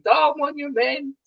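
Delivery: high-pitched yell held back in volume; mock waking scream. Runner waking at night from a race dream, mock screaming. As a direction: wail it high without really screaming. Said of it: "I wake up at night screaming".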